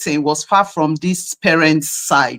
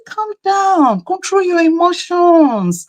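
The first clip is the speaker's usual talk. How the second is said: high, emphatic retold lecture; insistent, high-pitched admonishing; exasperated scolding; 9 semitones higher than usual. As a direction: tell off a parent in a raised pitch. Scolding the father to calm down.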